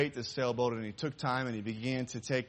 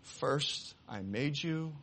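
soft, slow, tender words; tender whisper to boat; 6 dB quieter, slower than usual. Acted. Boy hugging his boat after buying it back, tender. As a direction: speak it lovingly to what you hold.

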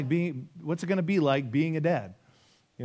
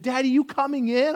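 put-on high, eager child's question; pitched-up little daughter voice; little girl; 9 semitones higher, 3 dB louder than usual. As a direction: imitate an excited four-year-old asking. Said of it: "she's like, she said".

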